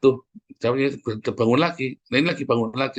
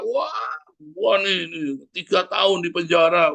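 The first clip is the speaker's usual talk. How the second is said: high-pitched, slow proud brag; boasting ex-con; 7 semitones higher, slower than usual. Retold or imitated. An ex-prisoner brags to his friends, in a high boastful voice. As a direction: show off the years in prison.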